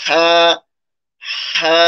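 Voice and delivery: হা opening on air before the vowel; breath-first ha. Shown as a demonstration. Teacher models starting with air, breathy-onset হা. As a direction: push breath first, then hold হা. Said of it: "আগে বাতাস দিয়ে শুরু করবেন".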